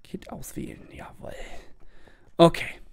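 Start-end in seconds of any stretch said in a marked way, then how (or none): 0.0-1.3 soft whispered aside. Real, not acted. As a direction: whisper it to yourself.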